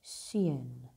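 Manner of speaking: seun with lips pursed as if blowing a kiss; rounded U. Shown as a demonstration. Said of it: "I'll pronounce that again".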